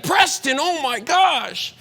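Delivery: high, loud and slow, like meeting a hero; the young climber realizes he's walking beside Preston, starstruck; starstruck gasp. Retold or imitated. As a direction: gush his name in disbelief. Starstruck fan meets famous podcaster.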